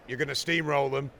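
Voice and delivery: ordinary delivery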